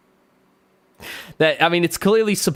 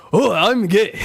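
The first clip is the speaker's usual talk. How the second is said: mock Japanese accent; 3 semitones higher, slower than usual. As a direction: put on a caricatured Japanese accent. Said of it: "Oh, yeah, can I do it?".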